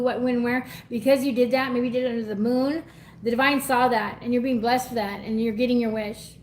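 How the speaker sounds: ordinary talk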